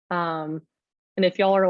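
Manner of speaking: ordinary talk, nothing marked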